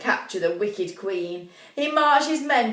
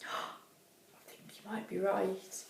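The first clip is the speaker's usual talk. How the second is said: hushed aside; 15 dB quieter than usual. Real, not acted.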